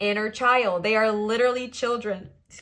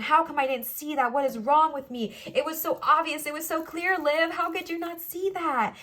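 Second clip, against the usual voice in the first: higher-pitched, exasperated self-reproach; self-scolding; 5 semitones higher than usual. Acted. Reader berating herself over past mistakes, exasperated. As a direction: scold yourself, climbing higher as it builds.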